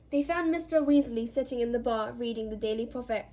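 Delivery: usual speaking voice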